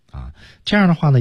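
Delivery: ordinary talk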